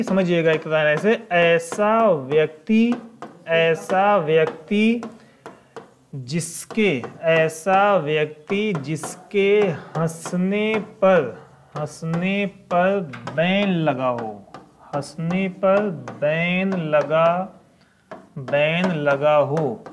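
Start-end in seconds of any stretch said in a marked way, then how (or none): 1.3-7.0 slow, deliberate, word by word; slow explanation. Shown as a demonstration. Teacher to students: a slow, deliberate memory trick. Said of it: "mnemonic explained slowly". 7.2-19.8 slow, deliberate, word by word; slow explanation. Shown as a demonstration. Teacher to students: a slow, deliberate memory trick. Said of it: "mnemonic hans-ban explained slowly".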